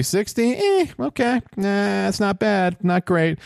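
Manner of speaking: high, shrugging voiced contestant; mock contestant. Commentator voicing the spinner, lightly high and shrugging. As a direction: toss it off like an unimpressed player.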